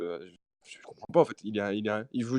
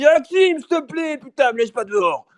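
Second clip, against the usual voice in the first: put-on high, pleading drunk voice; imitating a drunk begging at the door; 11 semitones higher, 6 dB louder than usual. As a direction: beg to be let in, drunkenly. Drunk neighbour pleading, retold.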